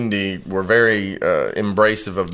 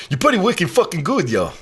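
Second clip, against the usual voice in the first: higher-pitched imitation Boston accent; 6 semitones higher than usual. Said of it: "talking in a Boston accent".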